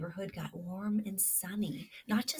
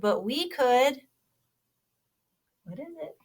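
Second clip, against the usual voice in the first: lively storybook read-aloud; reading to children; 2 semitones higher, 8 dB louder than usual. Acted. Teacher reading a kindness story to children, animated. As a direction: read it like a picture book to little listeners.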